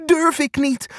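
high, timid little voice; frightened, high-pitched refusal; teeth-chattering fear. A narrator's reading. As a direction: squeak it out like a scared hare. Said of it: "klappert tand Angsthaas".

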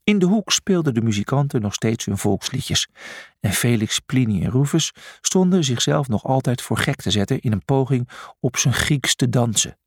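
ordinary delivery

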